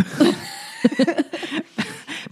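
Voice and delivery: real giggle trailing upward; high laugh. Real, not acted. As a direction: break into a light giggle. Laughs after wild story.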